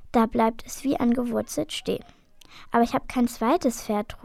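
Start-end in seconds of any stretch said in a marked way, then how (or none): none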